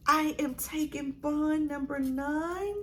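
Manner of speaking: high, slow, drawn-out announcement; dramatic reveal. Real, not acted. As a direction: stretch it upward toward a big reveal. Dramatic reveal of her purchase.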